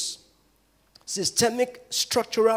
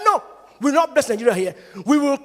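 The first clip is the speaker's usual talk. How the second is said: loud, fast insistent no; angry refusal; 6 semitones higher, 6 dB louder, faster than usual. Retold or imitated. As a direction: shut the idea down hard. Bishop refusing angrily on bus.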